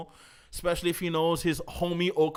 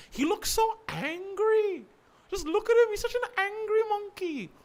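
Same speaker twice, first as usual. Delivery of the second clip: put-on gushing baby-talk; 5 dB louder than usual. Streamer admiring Wukong's angry face, high gushing coo.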